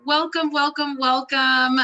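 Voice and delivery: loud, high, drawn-out greeting; exuberant welcome. Real, not acted. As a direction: welcome the whole crowd with open joy. Opening welcome to event audience.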